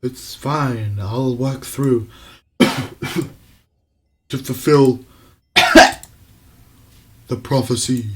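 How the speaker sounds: lightly voiced spirit forcing words through coughs; spirit coughing through. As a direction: push the prophecy out between coughs. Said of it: "spice stuck in my throat".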